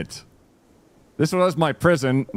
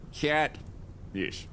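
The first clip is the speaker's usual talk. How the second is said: ordinary talk, nothing marked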